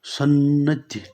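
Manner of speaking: सन्नद्ध said slowly with the doubled n held; teacher enunciating सन्नद्ध very slowly; slow clear pronunciation. Shown as a demonstration. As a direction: stretch सन्नद्ध out, hold the double न. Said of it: "सनद्ध ऐसे लिखते हैं".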